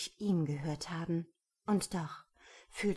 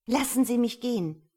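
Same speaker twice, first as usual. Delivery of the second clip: loud, high protest; urgent demand; 6 semitones higher, 10 dB louder than usual. A narrator's reading. Woman to the man holding her fast, urgent demand. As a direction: burst out louder and higher.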